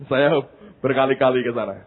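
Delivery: ordinary delivery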